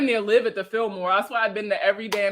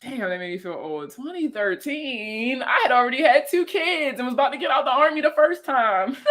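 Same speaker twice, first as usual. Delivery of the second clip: ordinary delivery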